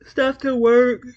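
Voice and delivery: words stretched by a yawn. Real, not acted. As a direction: talk through a big yawn. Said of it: "Excuse me, y'all".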